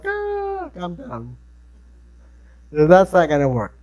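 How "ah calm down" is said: hysterical. Retold or imitated. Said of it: "you're hysterical".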